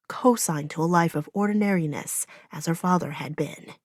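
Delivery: ordinary delivery, nothing marked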